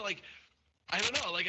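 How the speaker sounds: ordinary talk, nothing marked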